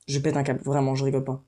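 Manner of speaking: normal talking voice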